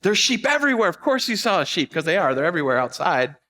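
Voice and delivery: mock the roommate scolding her; exasperated roommate. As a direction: roommate impatiently dismissing the sheep claim. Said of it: "they're like".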